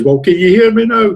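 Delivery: half-hearted imitation of shouting at a judge; retold shout. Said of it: "shouting out at the top of his voice".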